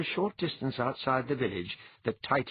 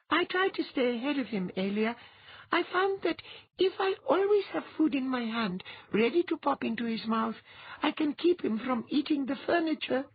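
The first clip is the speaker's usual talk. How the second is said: put-on tiny girl. Girl character voice.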